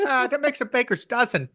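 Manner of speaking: put-on Chicago accent. Answering his own sketch quote, Chicago accent. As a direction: play the Chicago sports fan. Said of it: "those guys from Chicago".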